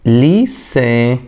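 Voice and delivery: ليسي said very slowly with a clear s sound; soft c as s. Shown as a demonstration. Teacher drawing out ليسي for students. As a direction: stretch out ليسي, s not k. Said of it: "شوفها مع بعض".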